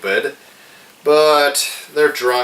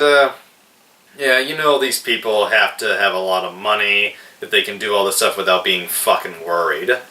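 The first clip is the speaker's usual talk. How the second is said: ordinary delivery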